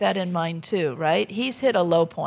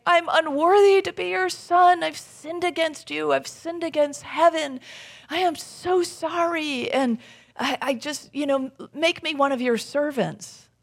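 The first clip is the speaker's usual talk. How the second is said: higher-pitched, imploring confession; pleading apology; 7 semitones higher than usual. Retold or imitated. Returned son begging his father's forgiveness, pleading.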